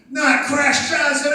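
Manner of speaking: high, slow shrieking scream; panicked shriek. Retold or imitated. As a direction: shriek it in mock terror like Sam Kinison. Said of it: "then Sam goes".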